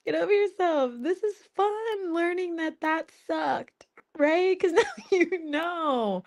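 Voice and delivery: scolding people who are stuck, high and slow; exasperated scolding. Retold or imitated. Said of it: "I'm like".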